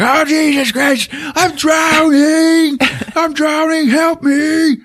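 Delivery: high, slow hammed-up cry for help; mock drowning panic. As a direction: wail it like a ham actor drowning. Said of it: "and he's like".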